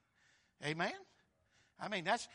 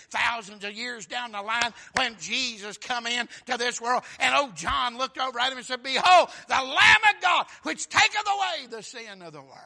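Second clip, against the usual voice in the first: climbs louder and higher to a shouted cry; building to a shout; 4 semitones higher, 12 dB louder than usual. Acted. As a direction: build up and blast the proclamation. Building to John's proclamation.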